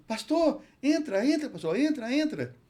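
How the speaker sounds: frantic high call to come in; urgent pleading mother. Retold or imitated. Dying child's mother urgently calling pastor.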